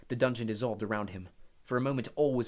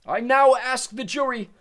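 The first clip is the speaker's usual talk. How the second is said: loud, high, slow shout; stern shout, much louder; 13 semitones higher, 14 dB louder, slower than usual. Acted. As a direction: shout over the room to the jury. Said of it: "shouted Mister Crouch".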